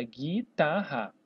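"guitarra" slowly as gui-tar-ra; "guitarra" drawn out in even pieces; syllable by syllable. Shown as a demonstration. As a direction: separate the three syllables of "guitarra" briefly. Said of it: "dessa maneira".

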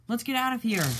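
high, loud urgent call; high, loud urgent cry to escape. Acted. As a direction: shout it while fleeing. Escaping the villain.

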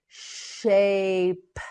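"Shape" stretched, p set apart; slow modelling. Shown as a demonstration. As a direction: draw out "Shape", then release the p. Teacher models word slowly.

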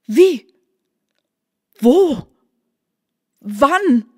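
hoch und laut, hastige verwirrte Fragen; stoßweise fragen, ganz durcheinander; verwirrt. A narrator's reading. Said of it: "fragte die Marquise verwirrt". (verwirrt und aufgeregt, jede Frage höher und lauter)

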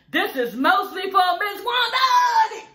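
high, loud mock outburst; hammed-up angry shout. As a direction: bellow it like a furious dedication. Retold angry dedication while smashing TV.

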